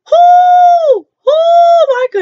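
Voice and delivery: high, loud shocked yelp; shocked shout. Real, not acted.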